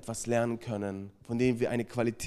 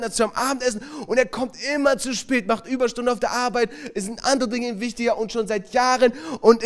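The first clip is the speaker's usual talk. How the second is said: higher, louder, rising to a shout; angry outburst; 9 semitones higher, 4 dB louder than usual. Retold or imitated. Wife tells the group why she hates her husband, building to an angry shout. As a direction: let years of anger burst out.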